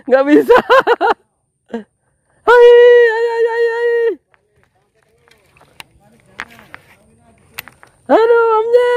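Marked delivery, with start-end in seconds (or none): 2.4-8.9 very high panicked calls; excited high cries. Real, not acted. Panik saat tarikan ikan. (berseru panik bernada tinggi)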